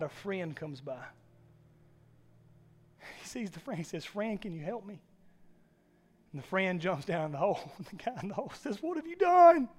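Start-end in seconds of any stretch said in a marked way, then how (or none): 4.0-5.0 faint plea. Acted. Weak voice after giving up. 8.8-9.7 high, incredulous outburst; can't believe what the friend just did; man in the hole, aghast at his friend; shocked. Acted.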